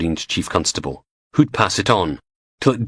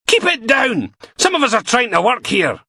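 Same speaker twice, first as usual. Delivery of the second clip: much higher, louder telling-off; loud rebuke; 3 dB louder than usual. Acted. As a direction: shut the room up. Steele silencing the room.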